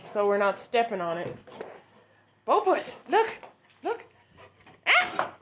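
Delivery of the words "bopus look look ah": calling the puppy. Real, not acted. Calling the dog.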